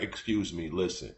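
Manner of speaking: rehearsing a soft apology to the coworker he upset; sheepish apology. Pretend. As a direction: ease in like offering a peace gift. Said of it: "go back to her girl, like".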